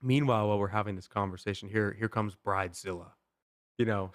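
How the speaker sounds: ordinary talk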